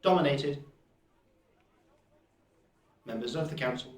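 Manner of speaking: strained, robotic golem voice; a robot voice under heavy strain. Acted. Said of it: "sounds like he's strained. It's still a robotic voice".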